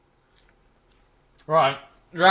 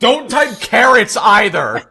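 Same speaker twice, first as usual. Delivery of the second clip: higher, louder, drawn-out protest; exasperated shout; 8 semitones higher, 7 dB louder, slower than usual. Real, not acted. Streamer telling chat to stop spamming, exasperated shout. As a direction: shout down the spam, half laughing.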